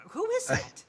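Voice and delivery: high, startled voice; startled bystander. Acted. Bystander startled by the unseen thing. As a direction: jump at something unseen.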